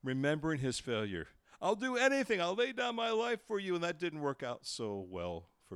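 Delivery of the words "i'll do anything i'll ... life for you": high, louder, eager promise; impassioned vow. Retold or imitated. Peter recalling his boast to Jesus, impassioned vow. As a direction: pledge everything with pitch thrown up. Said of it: "Peter's confident bravado".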